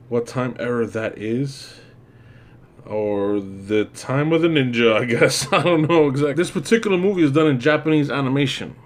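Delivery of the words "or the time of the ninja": usual speaking voice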